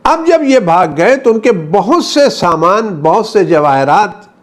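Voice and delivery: high-pitched, ringing sermon delivery; impassioned preaching. Real, not acted. Preacher telling of soldiers fleeing and leaving goods, impassioned.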